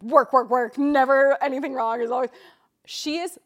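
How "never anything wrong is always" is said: normal talking voice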